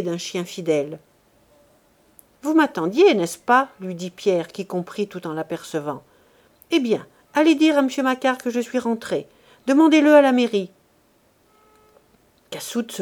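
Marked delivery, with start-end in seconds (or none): 2.4-3.7 Pierre's voice. Acted. Pierre addresses the waiting laborer. 6.7-10.7 Pierre giving orders. Acted.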